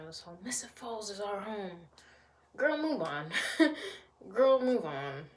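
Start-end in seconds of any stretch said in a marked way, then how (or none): 2.6-5.2 sassy telling off. Acted. Vlogger telling a TV character to move on, sassy. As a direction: snap it at her with attitude.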